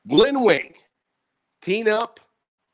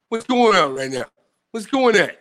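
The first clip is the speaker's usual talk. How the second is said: ordinary talk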